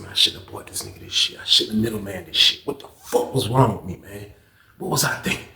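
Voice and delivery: hushed self-blame; regretful mutter. Retold or imitated. Narrator quietly blaming himself for the drugs, hushed. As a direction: whisper regret to yourself. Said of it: "I said".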